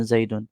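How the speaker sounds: زیدن said with an -un ending, "zaidun"; pesh ending. Shown as a demonstration. Teacher showing changeable endings, زیدن ending in -un. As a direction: end زیدن on a short u plus n. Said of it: "پیش میں ہم تلفظ کرتے ہیں".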